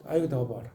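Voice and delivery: '버라'의 ㅂ을 입술 가볍게 순하게, 작게; 입술만 살짝 닿게 부드럽게 '더 버라'; soft light b. Shown as a demonstration. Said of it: "좀 순하게 읽는 거". (입술 가벼운 소리로 순하게 '더 버라')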